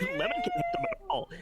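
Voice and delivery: high laughing yell; laughing shout. Real, not acted. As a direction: blurt it through laughter.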